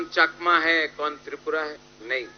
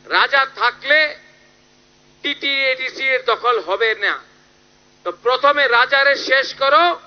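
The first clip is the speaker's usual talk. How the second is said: high, forceful mimicry of a politician; pitched-up, emphatic imitation of a minister; quoted chief minister; 5 semitones higher, 4 dB louder than usual. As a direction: quote a minister's orders in a raised rally voice. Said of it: "আমাদের মুখ্যমন্ত্রী উনি বলছেন কি".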